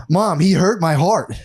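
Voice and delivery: put-on high, slow plaintive kid; mock wounded child. Sensitive boy complaining to mom, slow high wounded plea. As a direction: draw it out like a hurt little boy.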